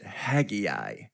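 Haggai said in three syllables, HAG-ee-eye; back-home pronunciation. Shown as a demonstration. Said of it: "back home, uh, we pronounced it".

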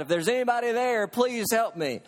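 mildly dramatized call for help; crying out for help. Retold or imitated. Man hanging off a cliff pleads upward, mock cry for help. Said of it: "he cries out".

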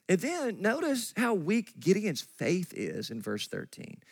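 ordinary talk

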